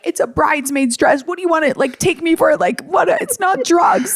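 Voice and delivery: imitating a tearful, exasperated outburst; mock sobbing. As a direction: plead in a high, hammed-up wail. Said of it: "sobbing, being like".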